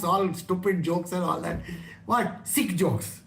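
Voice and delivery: normal talking voice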